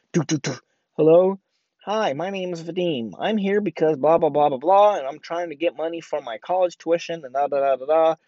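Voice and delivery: eager doorstep sales patter; door-to-door pitch. Retold or imitated. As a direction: mimic a kid selling newspaper subscriptions.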